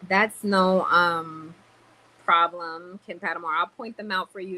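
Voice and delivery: ordinary talk, nothing marked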